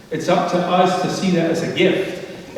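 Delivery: usual speaking voice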